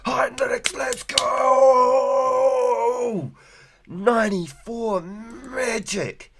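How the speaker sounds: high hype cheer, slow. Real, not acted. Cheering a viewer's level-up.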